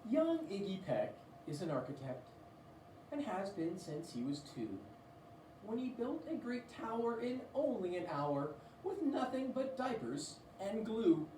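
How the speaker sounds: ordinary delivery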